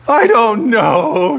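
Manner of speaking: slow, high, mock sobbing little girl; a small girl sobbing out her answer, hammed-up; imitating a child's tearful reply, slow. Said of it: "she sobbed".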